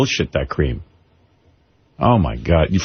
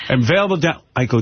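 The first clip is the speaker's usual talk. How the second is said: ordinary talk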